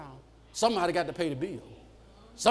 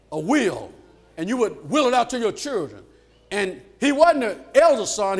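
high, driving pulpit cadence; preaching at full force; 6 semitones higher, 8 dB louder than usual. Real, not acted. Preacher telling Absalom's story to the church, preaching forcefully. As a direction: punch out the story in loud, raised bursts.

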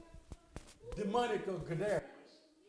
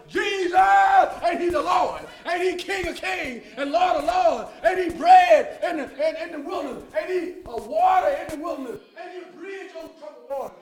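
hollering praise; 8 semitones higher, 14 dB louder than usual. Pretend. Preacher imagining himself in heaven hollering praise to Jesus. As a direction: holler each line up to heaven. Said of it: "I'm gonna be hollering".

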